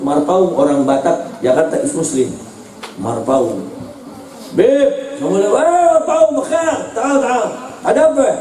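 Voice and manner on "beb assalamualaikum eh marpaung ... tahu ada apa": high-pitched calling out in acted greetings; excited greeting. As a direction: act out a surprised welcome for a visitor. Acted greeting between visitor and teacher.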